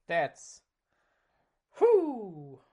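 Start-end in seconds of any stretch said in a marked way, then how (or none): 1.8-2.6 loud whew. Real, not acted. Reacting to bad priorities.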